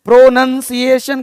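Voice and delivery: प्रोनंसिएशन with short "nun", not "noun"; correct pronunciation. Shown as a demonstration. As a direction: teach प्रोनंसिएशन syllable by syllable. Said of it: "क्या बोलना है?". (सही उच्चारण प्रोनंसिएशन, "नन" बोलकर)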